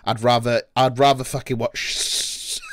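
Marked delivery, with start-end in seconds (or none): none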